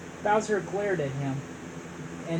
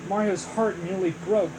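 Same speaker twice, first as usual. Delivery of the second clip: ordinary delivery, nothing marked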